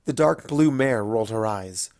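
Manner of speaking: ordinary delivery, nothing marked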